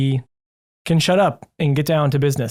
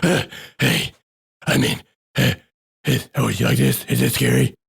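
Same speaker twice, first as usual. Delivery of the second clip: lightly voiced Batman dropping to his normal voice; a hint of Batman, deflated and unsure; mock Batman giving up the growl; Batman losing his voice; 2 semitones lower than usual. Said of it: "And then loses it".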